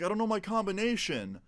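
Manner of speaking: high-pitched imitation of a pleading friend; mock helpless protest; helpless excuse.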